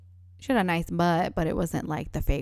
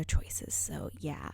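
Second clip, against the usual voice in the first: whispering; 3 semitones lower, 9 dB quieter, much slower than usual. Real, not acted. Whispered aside about school cliques.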